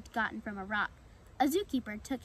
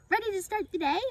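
high, loud eager squirrel; perky wake-up call; 9 semitones higher, 5 dB louder than usual. Acted. Squirrel waking the pen mates, high perky call. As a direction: chirp brightly to rouse the others. Lively squirrel wakes everyone up.

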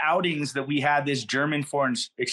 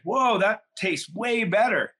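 a high, slow "whoa" of delight; pleasantly surprised; 8 semitones higher, slower than usual. Retold or imitated. Twelve-year-old reacting to his first spliff, impressed and surprised. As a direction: act amazed at the taste.

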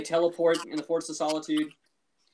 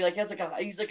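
normal talking voice

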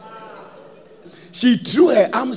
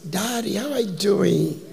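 a hint of a temptress cooing at a man; seductive voice. Preacher mimics a seductive woman, coy. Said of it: "normal voice".

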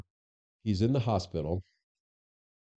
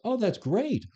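delighted; 13 semitones higher, slower than usual. Retold or imitated. Hearing the officer is charged.

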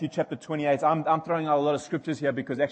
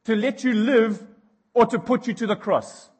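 much higher and louder, slow indignant demand; angry Pilate; 11 semitones higher, 10 dB louder than usual. Retold or imitated. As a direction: bellow it like a rattled governor. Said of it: "And Pilate goes".

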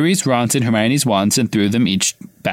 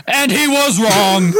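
Harry yelling; 10 semitones higher, much slower than usual. A narrator's reading. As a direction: yell it in betrayed fury. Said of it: "Harry yelled".

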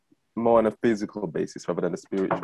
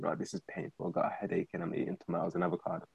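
much softer, near-whispered grumble; hushed inner thought; 3 semitones lower, 10 dB quieter than usual. Retold or imitated. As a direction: mutter it to yourself through a headache. Inner thought while hungover.

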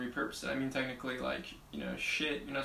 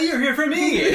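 laughing quote; 14 semitones higher, 16 dB louder than usual. Retold or imitated. Mocking the client's request.

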